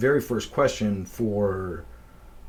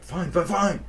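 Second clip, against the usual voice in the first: exasperated; 9 semitones higher, 4 dB louder than usual. Retold or imitated. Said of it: "he was like".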